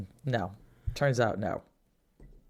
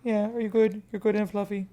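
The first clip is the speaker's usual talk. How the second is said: cooing to a cat; 8 semitones higher than usual. Real, not acted. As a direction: sweet-talk the fluffy cat. Talking to the cat.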